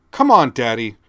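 loud impatient bark; barked order. A narrator's reading. Teen girl barking at her father, loud and impatient. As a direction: snap it back over the shoulder. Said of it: "she barked over her shoulder".

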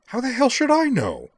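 very high, loud, exasperated outburst; exasperated retort. A narrator's reading. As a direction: fling it back, pitch shooting up. Flustered retort in hushed quarrel.

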